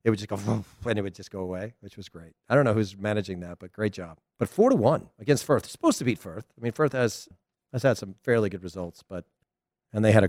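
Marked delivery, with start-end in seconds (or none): none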